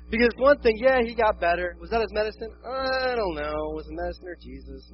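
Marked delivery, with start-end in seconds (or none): 2.6-3.7 long drawn-out "I don't know"; exaggerated uncertain hedging; mock doubt. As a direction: stretch it out, noncommittally. Mock doubt about the medicine's effect.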